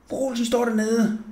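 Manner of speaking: plain reading voice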